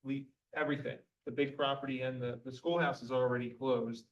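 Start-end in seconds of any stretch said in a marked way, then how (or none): none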